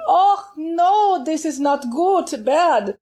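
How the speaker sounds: German teacher waving off a bad copy; Lehmann's German accent. Teacher scolds student for copying her recording, German accent. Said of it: "I loved her accent".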